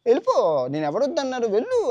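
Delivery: put-on old monkey shooing. Old monkey shoos Bhima off in a high, rising voice.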